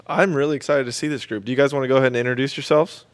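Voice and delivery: ordinary talk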